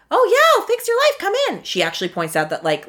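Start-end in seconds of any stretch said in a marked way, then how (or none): 0.1-1.6 much higher, louder, over-eager sales voice; mock eager life coach. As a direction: ham up a too-keen life coach. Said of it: "answer the phone and was like".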